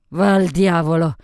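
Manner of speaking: loud outburst of temper; loud, defiant dismissal; angry drunken curse. A narrator's reading. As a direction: shout him away rudely. Drunk Sophie curses the owner.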